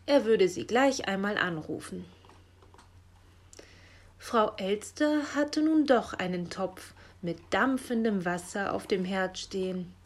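plain reading voice

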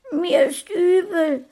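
hohe, weinende Kinderstimme; weinerlich. A narrator's reading. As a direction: klag unter Tränen. Blutverschmiertes Kind klagt. (weinerliche Kinderstimme)

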